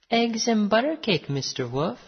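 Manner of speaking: lightly voiced innocent girl; little girl. A narrator's reading. Girl answering the wolf, high girlish voice. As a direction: start high, like a storybook child.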